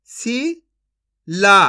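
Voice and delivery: Shila very slowly split as "शी ... ला"; syllable by syllable. Shown as a demonstration. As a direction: sound out Shila with a long gap inside. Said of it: "एक अक्षर का उच्चारण करके". (शिला को तोड़कर, धीरे-धीरे)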